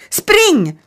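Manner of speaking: loud, very high shout; girl shouting to flee; calling out. A narrator's reading. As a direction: yell Run at your friend. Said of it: "ropade Danja".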